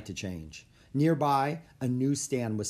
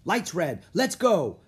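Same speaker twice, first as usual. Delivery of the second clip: high, loud, urgent command; excited holler to go; yelling; 7 semitones higher, 6 dB louder, slower than usual. A narrator's reading. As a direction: yell for everyone to get moving. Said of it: "yelled Jack".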